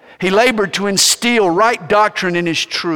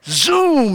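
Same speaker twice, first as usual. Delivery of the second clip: zoom sound effect; 10 semitones higher, 3 dB louder than usual. Acted.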